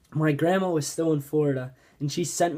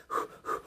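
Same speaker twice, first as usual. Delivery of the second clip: short mimed puffs of breath; imitated panting; 8 dB quieter than usual. Telling how he ran up holding the alligator, mock panting. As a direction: pant as if running. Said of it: "I'm, like, running to them".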